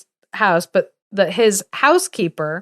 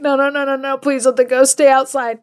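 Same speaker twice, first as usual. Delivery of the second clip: high, panicky mock plea; frightened pleading; 5 semitones higher than usual. Scared kids praying the ghost stays outside, frightened pleading. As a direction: beg in a higher, scared voice.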